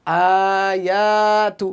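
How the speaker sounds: আয়াতু with both aa sounds drawn out; very slow, elongated আয়াতু; long vowels. Shown as a demonstration. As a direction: stretch আ and য়া slowly, short তু. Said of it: "টেনে".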